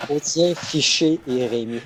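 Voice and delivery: names said with a full French accent, high; French pronunciation. Shown as a demonstration. Player tries the names in Quebec French. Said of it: "the S is silent in your language".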